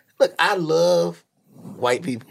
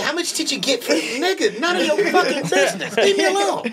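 raised laughing leave-me-alone; mock exasperated yell; 12 semitones higher than usual. Mock tourist exchange.

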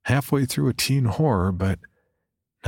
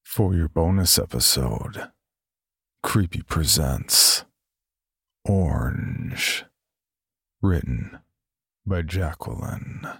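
slow, low story announcer; 4 semitones lower, slower than usual. Acted. Title intro in announcer voice.

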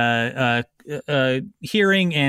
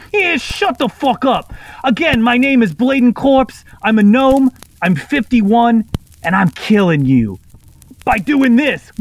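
loud, higher, bragging parroted yell; kenku mimicking gnome; 8 semitones higher, 7 dB louder than usual. Kenku replays the gnome's threat before the blast, loud and high. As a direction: squawk out the gnome's boastful confession. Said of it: "opens its mouth and says".

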